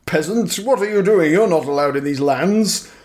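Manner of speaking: haughty knight ordering a peasant; lightly voiced pompous knight; pompous vain hero. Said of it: "some pompous knight".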